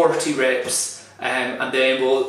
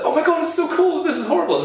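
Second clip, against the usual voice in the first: high, whiny shock at the cold; dramatic complaining; 11 semitones higher, faster than usual. Retold or imitated. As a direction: ham up the horror of icy water. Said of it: "connecting it up with the".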